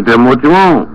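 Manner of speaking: ordinary delivery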